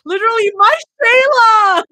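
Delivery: high, loud wail into crying; real crying out. Real, not acted. The reader overwhelmed by the story, crying out high and loud. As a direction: break down for real over the scene. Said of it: "reader overcome".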